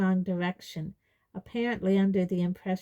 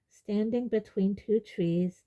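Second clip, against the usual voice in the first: usual speaking voice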